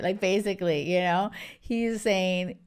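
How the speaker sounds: usual speaking voice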